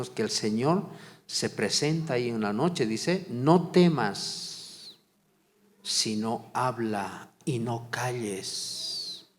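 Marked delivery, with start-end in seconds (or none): 3.3-9.3 very slow, weighty reading of the verse; slow solemn quote. Acted. Preacher quoting the Lord to Pablo, very slow and solemn. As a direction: stretch every word of the verse out.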